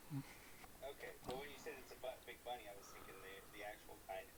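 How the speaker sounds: hushed quick whisper; breathy whispered aside. Real, not acted.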